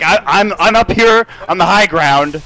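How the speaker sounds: slow, proud high-ground brag; hammed-up gloating from above; mock boast. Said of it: "He's like".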